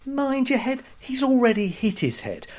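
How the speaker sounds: high-pitched scornful echo; mock incredulous. Comedian ridiculing the belated warning, mock incredulous. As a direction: throw the phrase back in disbelief.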